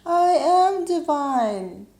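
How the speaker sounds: high, loud, slow holler; big drawn-out call falling at the end; yelling back. Acted. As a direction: shout it back, stretching each word. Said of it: "this time we all yell back".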